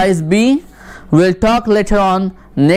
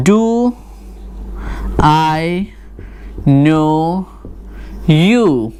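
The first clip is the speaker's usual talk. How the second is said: word by word; much slower than usual. Shown as a demonstration. Teacher dictating an example sentence to the class, slow and spaced. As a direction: Do I know you said slowly with pauses between words. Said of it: "let's have some examples".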